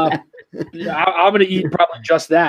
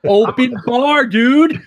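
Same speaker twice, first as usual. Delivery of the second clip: slow, loud, raised-pitch exclamation; excited; 6 semitones higher, 4 dB louder, much slower than usual. Real, not acted. Groom hyping the open bar to his friend, excited. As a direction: stretch it out high and loud with relish.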